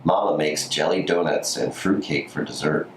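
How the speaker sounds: ordinary delivery, nothing marked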